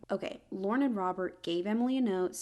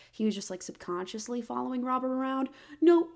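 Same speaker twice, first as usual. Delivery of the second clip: normal talking voice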